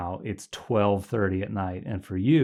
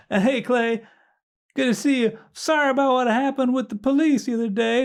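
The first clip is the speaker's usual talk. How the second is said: Ronnie Dobbs voice; 5 dB louder than usual. Retold or imitated.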